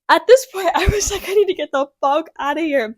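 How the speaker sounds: high, loud laughing panic; a high, loud laugh of disbelief. Real, not acted.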